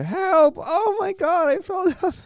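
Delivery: very high, loud wail for help; imitated screaming. Imitating mom fallen on the stairs, shrieking wail. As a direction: wail it in a falsetto mock scream. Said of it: "She's, like, screaming".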